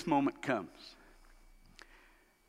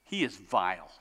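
deadpan mom; 9 semitones lower, 3 dB quieter than usual. Retold or imitated. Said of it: "she said".

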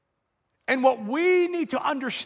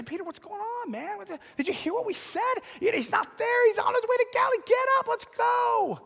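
high, rising to a shout, fast in the middle; woman pleading with Peter to get moving, excited; urging Peter; 11 semitones higher than usual. Retold or imitated. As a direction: shake him out of it, building to a call.

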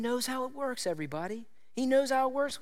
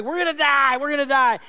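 pitched up, louder mock panic cry; 5 semitones higher, 7 dB louder than usual. Disciples in the storm, hammed-up panicked cry. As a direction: play the terrified disciples crying out. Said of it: "they freaked out".

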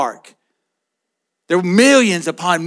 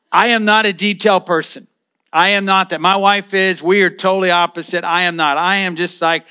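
normal talking voice

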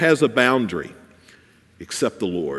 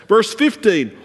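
usual speaking voice

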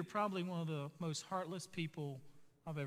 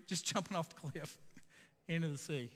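chuckling through the words; can't hold back a laugh picturing it; amused, laughing aside; laughing while telling. Real, not acted. Picturing pigs leaping off cliff.

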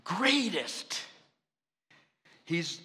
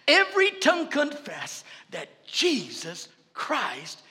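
loud, high commanding preaching; full force preaching; 4 semitones higher, 8 dB louder than usual. Real, not acted. Pastor commanding afflictions before the congregation, fervent shout. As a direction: command sickness to bow, building fervor.

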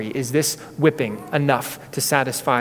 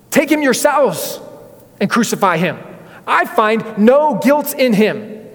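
much higher, slow, louder protest; exasperated Pilate; 7 semitones higher, 4 dB louder than usual. Retold or imitated. Pilate to the crowd, slow exasperated higher protest. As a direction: throw it back at them in frustration.

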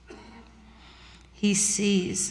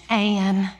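West Texas lady. Acted. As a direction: put on a folksy West Texas accent. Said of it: "my little West Texas lady voice".

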